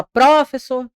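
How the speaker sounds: professor said as PRÓ-fessor; wrong first-syllable stress. Shown as a demonstration. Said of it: "a gente não fala professor".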